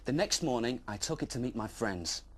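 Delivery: ordinary delivery, nothing marked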